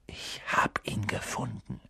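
stunned, hushed whisper. A narrator's reading. Boy telling friends of his discovery, whispering. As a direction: breathe it out in a whisper. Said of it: "flüsterte er".

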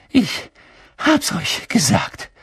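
high, gasped words with breaks between; panting. A narrator's reading. Hermine scolding her friends after running, panting. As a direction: gasp it out, out of breath. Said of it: "keuchte Hermine".

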